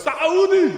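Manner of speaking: Saudi yelled high as "Sa-OO-di"; loud, high mock-Arabic Saudi, long "oo"; hiccuping Saudi. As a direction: belt out Saudi with a hiccup-like catch. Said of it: "I thought he hiccuped or something".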